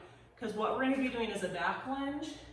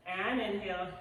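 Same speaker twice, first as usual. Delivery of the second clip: usual speaking voice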